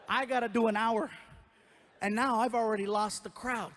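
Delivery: ordinary talk, nothing marked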